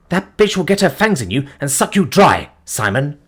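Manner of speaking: high, urgent, venomous; insistent warning. Acted. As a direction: press the warning home. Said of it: "Sean insisted".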